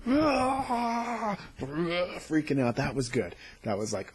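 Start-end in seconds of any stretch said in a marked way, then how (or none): 0.1-1.4 short scream, much higher; a lightly done horror-movie scream; mock scream. Retelling the dead waking in the morgue, mock scream. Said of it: "everybody is like".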